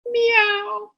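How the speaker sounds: tiny, high, soft mew; kitten meow. Acted. Supposedly ferocious beast found under bed, tiny meow.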